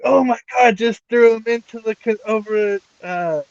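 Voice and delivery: mock commentator losing it over the action; hammed-up excited commentary call. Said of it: "he's like".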